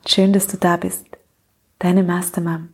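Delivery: ordinary talk, nothing marked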